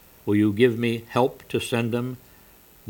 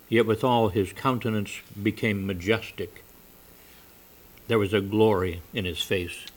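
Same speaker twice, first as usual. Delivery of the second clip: ordinary talk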